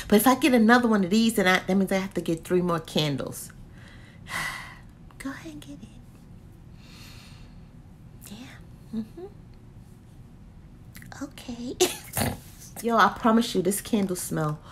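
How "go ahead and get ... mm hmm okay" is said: fast whispered self-persuasion; quick tempting whisper to self. Real, not acted. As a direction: talk yourself into it in a whisper. Whispering to self about buying.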